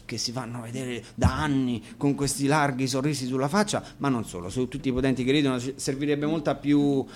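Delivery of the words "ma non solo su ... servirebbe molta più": usual speaking voice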